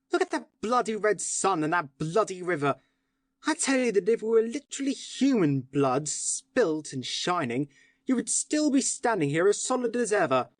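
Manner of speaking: angry tirade. Acted. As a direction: pour out scorn at the calm policeman. Syme rages at the policeman.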